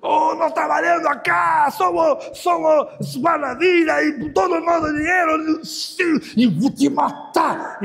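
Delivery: loud, high, slow raging shout; the lifeguard threatening to kill; retold furious threat. Voz do gringo ameaçando. (ameaça gritada, lenta e aguda)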